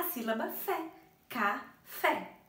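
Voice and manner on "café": "Café" split in two, with FÉ hit hard; slow Ca, then a punched FÉ in "Café"; teacher showing the stressed FÉ in "Café"; stress on final syllable. Shown as a demonstration. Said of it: "É a mais forte".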